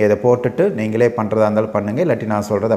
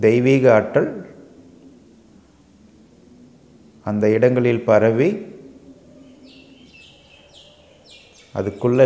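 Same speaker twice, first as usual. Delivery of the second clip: measured meditation guide, long pauses between phrases; guided meditation. Acted. Leads a guided relaxation meditation. (நிதானமா, இடைவெளி விட்டு வழிநடத்தும் தியானக் குரல்)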